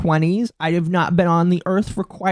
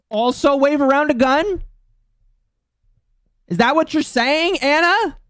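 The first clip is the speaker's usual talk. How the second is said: incredulous question; 8 semitones higher than usual. Real, not acted. Streamer demands an answer from Anna Valens, pitch leaping high in disbelief. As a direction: pitch leaps much higher in disbelief.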